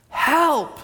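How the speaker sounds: high, sharp cry of help; retold scream for help. Woman in the surf calling for help, high cry. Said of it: "I heard someone scream".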